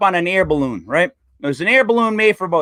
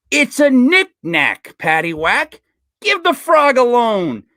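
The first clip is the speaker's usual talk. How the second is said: slow, raised, deliberate delivery; slow nursery-rhyme cadence, pitched up; drawn-out punchline; 4 semitones higher, slower than usual. Acted. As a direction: stretch out each phrase of the pun. Delivers the joke's pun punchline.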